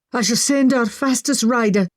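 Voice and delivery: plain reading voice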